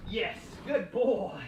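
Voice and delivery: slow, sing-song praise for the dog; drawn-out praise. Real, not acted. Trainer praising the dog, slow and upbeat. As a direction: stretch it out, rewarding the pup.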